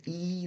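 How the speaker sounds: E as a long, wide ee; wide vowel. Shown as a demonstration. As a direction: hold E long with the mouth spread wide. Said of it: "This is very wide".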